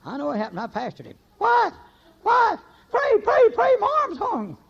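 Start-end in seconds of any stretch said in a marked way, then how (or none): 1.4-4.6 high, loud panicked begging, acted out; panicked plea. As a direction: beg for prayer in mock panic. Husband panics, arm stuck.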